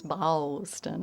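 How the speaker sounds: "Boston" stretched into three or four syllables; soft, many-syllabled "Boston"; drawn-out Southern pronunciation. Shown as a demonstration. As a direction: stretch the vowel of "Boston" with a Tennessee twang. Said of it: "three or four syllables out of it".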